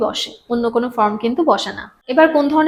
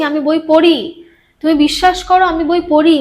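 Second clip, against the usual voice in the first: higher-pitched, insistent protest; forceful insistence; 3 semitones higher, 3 dB louder than usual. Shown as a demonstration. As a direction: press hard on পড়ি. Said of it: "আমি জোর করে বলছি".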